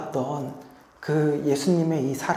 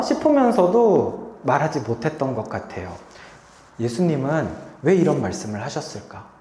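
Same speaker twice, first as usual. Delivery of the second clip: ordinary talk, nothing marked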